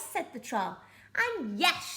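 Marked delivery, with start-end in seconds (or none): 1.1-1.9 pirates shouting. Acted. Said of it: "shouted the pirates".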